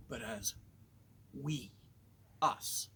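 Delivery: ordinary talk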